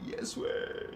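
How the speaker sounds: very high squeaky laughing Yes way; squeaky dude quote. Retold or imitated. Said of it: "Says the other dude".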